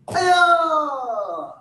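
long, high kiai yell; drawn-out falling "hi-ya" battle cry; loud yell. Real, not acted. Instructor jumping into sitting stance with a long loud yell. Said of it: "with a loud yell".

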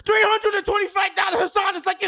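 high, loud outraged yelling; angry shouting. Pretend. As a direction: yell like a furious fixer. Impersonating Taffer yelling.

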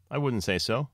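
lightly voiced modest bunny. A narrator's reading. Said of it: "confessed Lily Bunny modestly".

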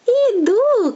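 high, eager call out; calling fox. Acted. Fox calls from afar to the sparrow, a bright high call. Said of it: "кричить лисиця".

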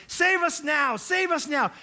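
high, loud crowd shout; crying out. Retold or imitated. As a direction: shout it as a desperate plea. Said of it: "crying out saying".

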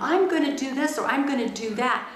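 retold childish vow of payback; defiant to mom. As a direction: throw "I'm gonna" at mom in mock defiance. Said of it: "I would even say to my mom".

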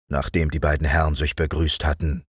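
plain reading voice

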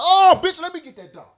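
high, loud yell at the owner; furious shout. Retold or imitated. Man yelling at the dog owner after the bite, high loud shout. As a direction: roar it out, ready to grab the dog. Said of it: "I said".

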